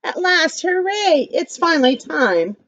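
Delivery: high cheerful exclamation; excited. Acted. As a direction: cheer it like a happy kid. Reading excited book line.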